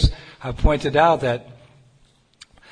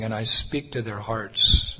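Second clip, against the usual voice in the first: normal talking voice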